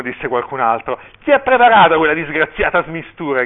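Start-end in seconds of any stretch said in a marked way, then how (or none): 1.2-3.4 loud, high exasperated demand; angry shout. A narrator's reading. Furious father asking guests who mixed the punch, shouting. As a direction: burst out loudly, demanding the culprit.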